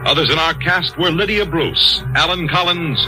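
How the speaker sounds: ordinary delivery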